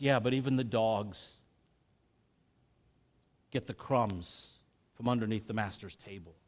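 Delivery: soft, low, humble answer; quiet humble reply. Acted. Canaanite woman answering Jesus, soft and humble. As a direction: answer back gently and humbly. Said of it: "And she goes".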